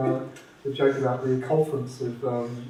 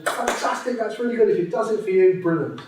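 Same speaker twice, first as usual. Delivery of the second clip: much higher, gushing mock praise; gushing crowd; 9 semitones higher, 5 dB louder than usual. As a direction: overdo the crowd's delight.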